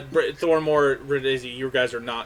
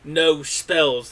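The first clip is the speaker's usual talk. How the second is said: announcer rules; 4 semitones higher, 5 dB louder, much slower than usual. Acted. GM voices pit announcer.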